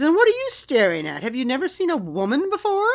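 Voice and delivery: Irish woman. Retold or imitated. Brenna to the staring men, indignant Irish accent. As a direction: confront a gawker in an Irish lilt. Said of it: "perfect Irish woman".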